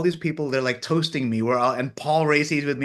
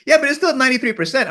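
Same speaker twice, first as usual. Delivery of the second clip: imitating the team, higher, louder and fast; eager insistence; 11 semitones higher, 6 dB louder, faster than usual. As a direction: rush it out, brightly insisting.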